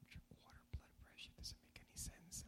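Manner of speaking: soft whisper of the father's words; whispered echo. Retold or imitated. Preacher replaying his father's advice in a whisper.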